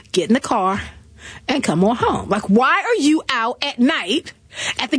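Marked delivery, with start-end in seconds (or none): 0.1-2.3 lower, firm order; lightly voiced husband calling her home; a hint of a stern husband. Husband calling on phone, lower and firm.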